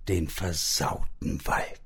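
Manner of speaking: soft whispered pun; whispered aside. A narrator's reading. Narrator hushes the pun.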